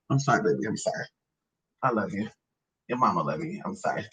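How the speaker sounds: low, soft soothing murmur; tender apology. Real, not acted. Mama tells baby she loves them, tender.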